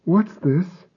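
small trembling high voice; tiny quivering voice. Acted. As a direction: ask it shaking and small. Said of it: "in a tiny, quivering voice".

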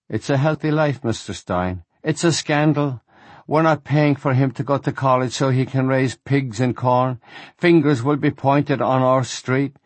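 put-on a German-accented father lamenting his lost son; distraught German accent. Rabbi complains to the teacher about his son, in a pained German accent. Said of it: "He had a German accent".

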